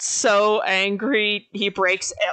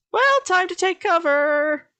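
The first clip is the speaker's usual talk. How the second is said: high, sing-song cartoon bystander; onlookers taking cover; 9 semitones higher than usual. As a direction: voice a character bowing out, pitched well up. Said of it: "Everyone else".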